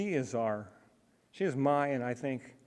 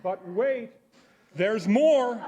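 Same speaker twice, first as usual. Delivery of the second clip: loud, higher sales pitch voice; infomercial announcer; 9 semitones higher, 10 dB louder, much slower than usual. Acted. Infomercial catchphrase for surprise.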